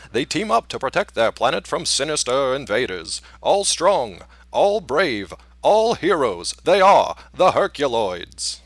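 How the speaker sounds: big dramatic cartoon intro narration; old-timey newscaster. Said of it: "could you do an old-timey newscaster?".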